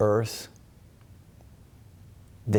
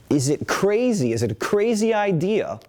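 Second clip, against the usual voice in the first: crazy lifted higher with a falling finish; pointed question; 10 semitones higher than usual. Shown as a demonstration. Teacher pressing the question on the class, higher and pointed. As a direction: throw crazy up high, then drop it.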